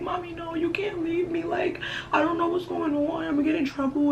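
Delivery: high, whiny, teary pleading; mock crying plea. Begging her mother not to leave her, whiny teary plea. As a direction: beg like a panicked kid about to cry. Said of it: "I'm, like, crying".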